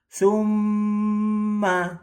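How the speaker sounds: summa with mm held three beats, slow and high; held doubled m. Shown as a demonstration. Teacher demonstrating mim tasdid, mm held. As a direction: hum the mm of summa long. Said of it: "ditahan tiga harokat".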